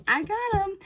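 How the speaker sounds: smug gloat. Retold or imitated. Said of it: "they're like".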